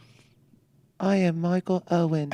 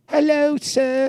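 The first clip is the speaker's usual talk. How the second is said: put-on slow, higher, posh English accent; 8 semitones higher, slower than usual. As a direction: play up a posh English gent. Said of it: "Talks like this".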